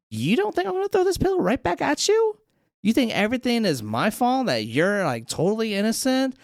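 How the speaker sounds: high, indignant challenge; hammed-up petulant accusation; mock quarrel. Acted quarrel, threatens to throw pillow.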